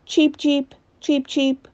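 high, quick cheep cheep; sparrow cheeping. A narrator's reading. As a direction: chirp it out like a little sparrow. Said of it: "interrupted the sparrow".

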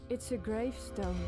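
usual speaking voice

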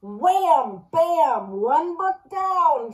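loud gleeful shout; triumphant shout over a fallen book. A narrator's reading. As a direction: punch out the wham and bam. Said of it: "shouted Joke Book".

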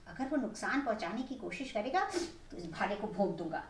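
put-on old men scheming in hushed tones; hushed plotting. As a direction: keep it down so it won't hear. Old men plotting near the creature.

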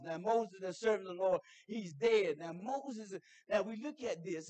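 usual speaking voice

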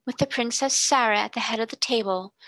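a touch of scornful mockery; withering sarcasm. A narrator's reading. Headmistress mocking the girl's party, mildly withering. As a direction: sneer the title lightly. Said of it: "said Miss Minchin witheringly".

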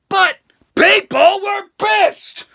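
drawn out, loud and high; slow, loud, high declaration; slow emphatic line. Real, not acted. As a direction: stretch it out to stress the outrage.

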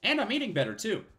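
high, chipper health-nut voice; chirpy health-nut Michio. Acted. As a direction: play the reformed bar owner, bright and pleased with himself. Voices Michio reading dialogue.

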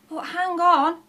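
loud, high groan of doubt; groaning objection. A narrator's reading. Shifty doubts his partner's plan, groaning. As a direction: groan it as a protest. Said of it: "groaned Shifty".

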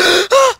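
loud, high excited gasp; ecstatic gasp. Real, not acted. Player finding diamonds, loud ecstatic gasp. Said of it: "Ah, un orgasme".

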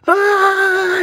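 very high shrieking wail; high wailing yell. A narrator's reading. Boy mortified at the mall, shrieking wail. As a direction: let out an anguished high cry. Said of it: "yelled Taylor J".

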